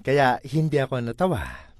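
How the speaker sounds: usual speaking voice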